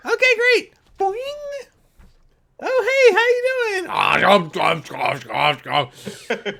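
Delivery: very high, loud squeaky creature; cartoon creature voice. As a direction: bounce along as a tiny critter. GM voicing cheerful creature.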